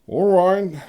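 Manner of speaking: a reluctant yes; Konrad's voice. A narrator's reading.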